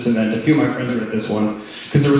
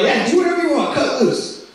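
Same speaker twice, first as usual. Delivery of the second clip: mock DJ; 11 semitones higher than usual. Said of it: "I was like".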